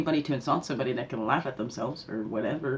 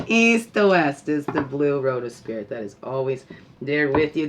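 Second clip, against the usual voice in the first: ordinary talk, nothing marked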